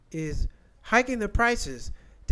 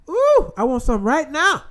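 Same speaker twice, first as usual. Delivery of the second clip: craving excitement; 8 semitones higher, 10 dB louder than usual. Real, not acted. Craving Waffle House.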